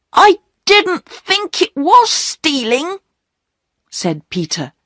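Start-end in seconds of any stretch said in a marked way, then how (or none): 0.1-3.0 put-on very high-pitched child's excuse; pleading young boy. Peter explaining himself to the station master, pleading young boy. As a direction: excuse yourself in a high boy's voice.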